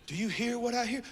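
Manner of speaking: softly voiced, lilting question. Prophet presses the listener to hear, soft questioning. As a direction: ask it softly but fully voiced. Prophet asks it again, higher.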